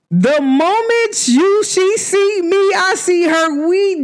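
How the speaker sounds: high, slow, beaming delight; light right up as you picture her; gushing over the cousin's wife, high and drawn out. Real, not acted.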